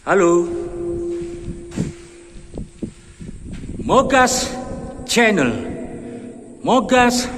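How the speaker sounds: calling out to hear the echo; echoing tunnel. Real, not acted. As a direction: shout it into the tunnel and let it ring. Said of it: "Itu suaranya yang menggema di dalam terowongan". (teriak bergema di dalam terowongan)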